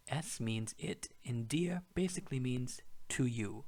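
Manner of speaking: whispered aside. Real, not acted.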